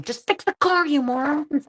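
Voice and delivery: high-pitched exasperated holler; mock yell. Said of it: "Can you go yell that at them?".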